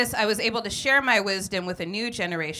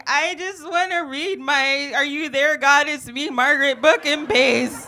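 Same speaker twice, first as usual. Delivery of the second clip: high, loud, slow nerdy whine; whiny kid self; 6 semitones higher, 9 dB louder, slower than usual. Retold or imitated. Picked-on kid begging the bully to leave her alone, loud whine. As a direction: plead like a picked-on bookish kid. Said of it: "And I'm like".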